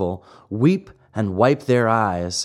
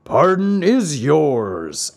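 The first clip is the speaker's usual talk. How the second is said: slow, higher, lightly voiced grandee; a touch of grand puppet master; magnanimous Fire Eater; 4 semitones higher, slower than usual. A narrator's reading. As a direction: pronounce the pardon slowly. Fire Eater grants the pardon.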